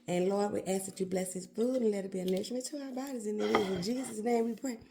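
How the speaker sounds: soft, low saying grace; quiet prayer. Real, not acted. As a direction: pray it softly and sincerely. Says grace before eating.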